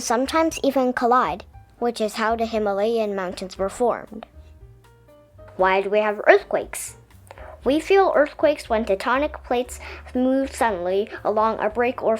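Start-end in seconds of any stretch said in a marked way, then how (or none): none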